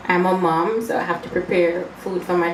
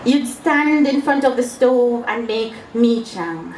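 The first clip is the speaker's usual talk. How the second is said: raised, rhythmic verse reading; poetry recital; 4 semitones higher than usual. Acted. Poet recalls a treat being made at the stove, poetry recital. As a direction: recite the steps as a fond memory in verse.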